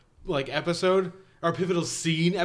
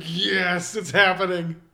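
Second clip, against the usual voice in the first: excited; 4 semitones higher, 5 dB louder, much slower than usual. Retold or imitated. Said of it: "And I was just like".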